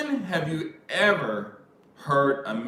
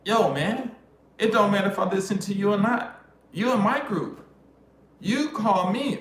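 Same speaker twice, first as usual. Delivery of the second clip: high, louder retold argument; indignant protest; 9 semitones higher, 4 dB louder than usual. Arguing back at a guy in his group, indignant. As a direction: act out setting him straight, pitched up.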